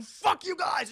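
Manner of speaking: high-pitched acted yell; defiant mock shout. Mel defies his torturers, mock defiant shout. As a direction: shout it like a tortured action hero.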